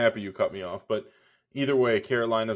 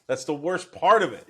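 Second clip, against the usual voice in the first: repeated louder and higher, with emphasis on part; 4 semitones higher, 7 dB louder than usual. Real, not acted.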